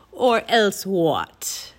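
very slow, drawn-out villain taunt; mocking Raven King. A narrator's reading. Raven King dares the children, slow and mocking. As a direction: stretch the taunt out slowly. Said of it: "he asked in a raspy voice".

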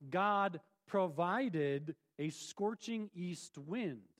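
drawn-out, deliberate reading; slow each word of God provided down; stressing that God provided, slowly; slow emphasis. Shown as a demonstration.

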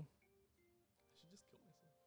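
slow, breathy whisper; whispered deadpan. Acted. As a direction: mutter it flat as a dark joke. Deadpan joke, unvoiced and slow.

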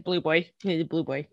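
soft, resigned and low; resigned mutter. Retold or imitated. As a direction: give up on the name, quietly. Resigned retold thought.

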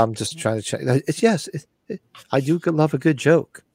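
ordinary talk, nothing marked

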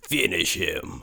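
low, slow game announcer; Mortal Kombat. Shown as a demonstration. Repeating the game line on request, low announcer voice. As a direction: deepen and stretch it like a fighting-game announcer. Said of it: "Do that again, the Mortal Kombat".

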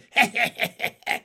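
high, loud raspy chuckle; gloating staccato laugh; bullfrog cackle. A narrator's reading.

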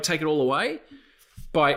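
ordinary talk

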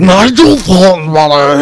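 big, loud, drawn-out British cartoon voice; hammed-up British bellow, high and slow; slow, over-the-top character impression; Nigel Thornberry. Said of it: "you do a good Nigel Thornberry".

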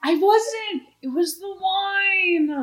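whiny protest. Acted. Defends himself, high whine.

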